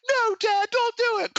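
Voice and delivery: put-on very high, squealed kid's plea; high-pitched imitation of a panicked child; mock pleading child. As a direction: beg Dad in a hammed-up falsetto. Voices child pleading with dad.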